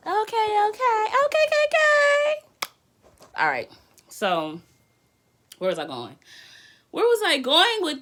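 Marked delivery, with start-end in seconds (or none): 0.1-2.4 high, loud squealing okays; excited squeal. Real, not acted. Streamer squealing with excitement at the card.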